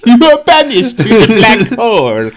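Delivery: loud, high mock sentencing; gatekeeper voice. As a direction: shout the banishment as the gatekeeper. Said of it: "He was always, he's like".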